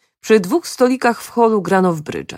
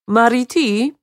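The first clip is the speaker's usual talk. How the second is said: higher-pitched call of a name; 6 semitones higher, slower than usual. A narrator's reading. Mr Balu hailing the woman as she leaves, calling out. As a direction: call her over from the card table. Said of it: "zawołał pan Henry Balu".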